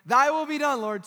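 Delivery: loud, high mock-pious prayer; half-meant prayer. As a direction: pray it big and insincerely. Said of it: "and we're like".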